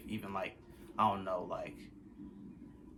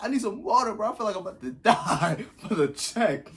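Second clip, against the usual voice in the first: much higher and louder, half-laughing; desperate plea; 11 semitones higher, 7 dB louder than usual. Retold or imitated. Begging his friend for water, loud and high with a laugh. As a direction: beg loudly like you're fading.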